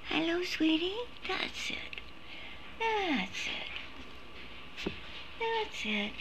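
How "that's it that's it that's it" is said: barely audible breathy praise; soft whispered praise. Real, not acted. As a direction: whisper encouragement to the pet. Praising pet softly.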